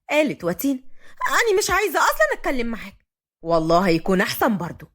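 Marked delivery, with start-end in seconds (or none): 1.3-3.1 high-pitched offended retort; huffy. A narrator's reading. Watin tells off Fahd, huffy. Heroine snaps back at Fahd. 3.4-4.9 low-pitched male figure, even; young man's voice. A narrator's reading. Fahd answers his grandfather, low and even.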